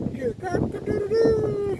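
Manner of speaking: high, stretched rooster crow; slow mock cock-a-doodle-doo. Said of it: "We heard roosters waking us up".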